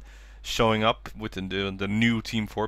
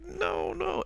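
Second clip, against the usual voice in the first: much higher, breathy mock wail; imitated crying; 6 dB quieter than usual. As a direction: whimper it like a crying girl. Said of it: "on the ground crying".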